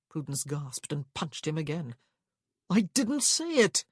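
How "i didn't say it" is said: higher, louder, drawn-out protest; indignant self-defence. A narrator's reading. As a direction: object as if wrongly blamed. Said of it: "Rowan protested".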